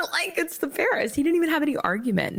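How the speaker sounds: high, laughing excitement; bursting with giddy disbelief; excited. Real, not acted. Excited retelling, laughing start.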